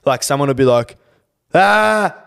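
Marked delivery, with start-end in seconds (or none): 1.5-2.2 loud high mock "ah" to drown a sound; hammed-up cover noise; fake noise to cover a can. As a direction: blurt a big fake "ah" to hide the can clicking. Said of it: "it normally goes like this".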